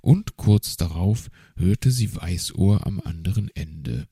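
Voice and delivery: plain reading voice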